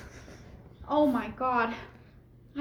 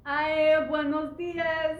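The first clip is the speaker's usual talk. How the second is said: hammed-up Spanish accent, slow on dias; fake Spanish accent; slower than usual. Daughter in disguise greeting strangers, fake Spanish accent. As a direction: put on a bad Spanish accent to hide. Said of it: "French accent".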